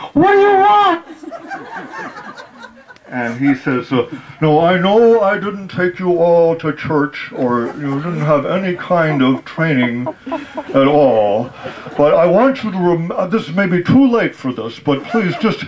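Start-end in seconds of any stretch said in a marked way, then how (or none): 0.1-1.1 daughter's reply. Retold or imitated. Daughter answers from the top. 4.4-15.6 pleading father. Retold or imitated. Father lecturing his daughter.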